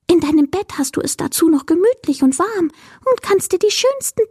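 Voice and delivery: high, dreamy little creature voice; enthusiastic will-o'-the-wisp. A narrator's reading. Creature winning Mila over to the night, high and delighted.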